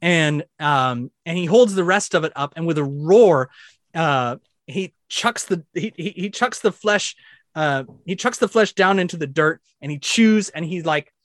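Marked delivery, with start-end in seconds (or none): none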